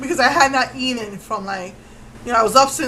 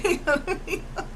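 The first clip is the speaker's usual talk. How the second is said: laughing reassurance; 6 semitones higher, 4 dB quieter, faster than usual. Retold or imitated. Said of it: "so she's saying".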